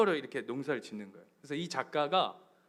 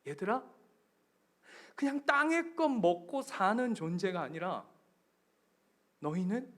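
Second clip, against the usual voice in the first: voice of Jesus; 7 semitones higher, slower than usual. Retold or imitated. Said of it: "예수님이 하시는".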